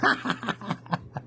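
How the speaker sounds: high, soft staccato chuckle; acted string of high ha-ha-has; bear's laugh. A narrator's reading. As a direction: laugh mockingly as the jolly bear. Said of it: "and he laughs".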